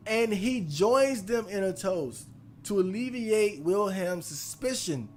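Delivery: ordinary delivery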